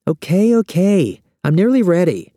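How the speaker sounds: high, loud, exasperated call back; annoyed. A narrator's reading. Woman in bathroom answering a hurrying man, annoyed. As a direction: answer impatiently, voice pitched right up. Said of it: "She sounds annoyed".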